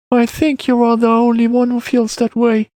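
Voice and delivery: put-on high-pitched boy disagreeing; child character. A narrator's reading. Boy doubts the man's reassurance, high child voice.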